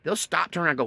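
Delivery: ordinary talk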